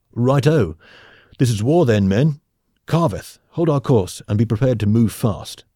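rally the crew for battle like a space captain. Acted.

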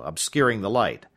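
usual speaking voice

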